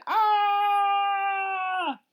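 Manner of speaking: long high loud scream; long piercing monster scream. Acted. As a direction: let out a drawn-out monster shriek. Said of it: "Those monsters screamed".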